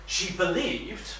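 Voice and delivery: believed drawn out slowly and leaned on; stress on believed. Shown as a demonstration. Speaker casting doubt on Carson's belief, stressing believed. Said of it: "and I stress the word believed".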